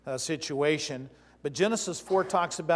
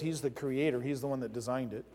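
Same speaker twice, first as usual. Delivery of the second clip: normal talking voice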